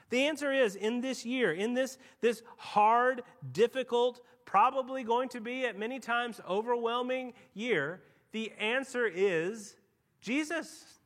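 loud, building to a high Jesus; preaching at full force. Acted. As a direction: hammer it home, rising at the end. Preaching climax.

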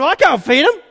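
high, loud panicky pleading; a mock panic over feeding the crowd; panicking. Disciples panic to Jesus about the food, high and loud. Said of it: "they start to panic".

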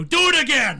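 furious, loud and high; angry shout. Pretend. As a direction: yell it right in their face. Throws coffee, yells at barista.

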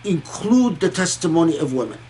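ordinary talk

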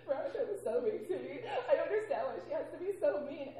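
hammed-up hurt complaint; imitating a wounded coworker, half laughing; mock whiny coworker. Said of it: "someone will cry".

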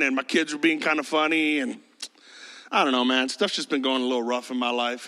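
low, sighing boss making excuses; reluctant boss. Acted. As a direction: beg off wearily, voice sinking low. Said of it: "And the boss goes".

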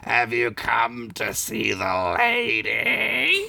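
slow gravelly rumble; a slow, lightly gravelly menace; low gravel vigilante growl. Acted. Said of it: "a gravelly voice rumbled".

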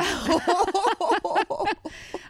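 high, loud laughing burst; long laugh. Real, not acted. Storyteller cracking up at her comeback, long laugh.

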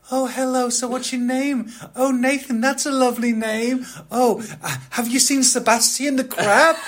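high, sugary princess voice up top; Ariel voice. Retold or imitated. Disney host Ariel greeting diners at dinner, high sugary voice. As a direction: gush at the guest like a theme-park Ariel. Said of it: "Ariel there saying".

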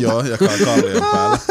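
amused confirmation with laughter; laughing. Real, not acted. Friend confirming his crude joke, laughing. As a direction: say it yes-and-laughing.

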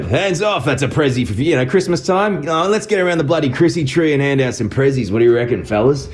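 high, broad Australian bloke voice; rally the mates round the Christmas tree; Aussie accent. Acted. Voice actor doing his Aussie character Fuse.

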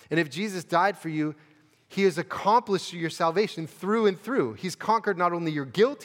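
preaching at full force. Acted. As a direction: preach it harder, lifting toward the climax. Sermon building to climax.

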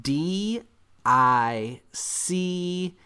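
one letter at a time, long pauses; spelled out slowly. Real, not acted. Hungover man telling how he felt, spelled slowly. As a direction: draw out each letter for effect.

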